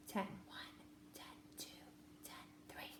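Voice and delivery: whispered counting. Shown as a demonstration. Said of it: "whisper count as you do it".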